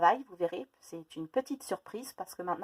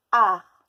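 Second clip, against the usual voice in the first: art dit très fort; mot appuyé; 14 dB louder than usual. Shown as a demonstration. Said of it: "J'ai ensuite le mot". (mot crié)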